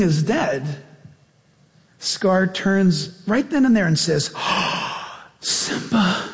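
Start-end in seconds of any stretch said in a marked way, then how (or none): 4.4-6.3 breathy, voiceless gasp of mock shock; feigned horror. Scar feigning shock to Simba over the dead king, hushed mock horror. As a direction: gasp it softly, as if appalled.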